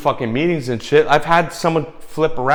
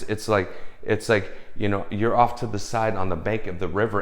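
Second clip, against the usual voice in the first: normal talking voice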